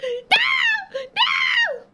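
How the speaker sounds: climbing to a very high hammed-up shriek; building mock wail. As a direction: escalate the fake despair. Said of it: "It's like".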